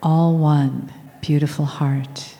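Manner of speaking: ordinary talk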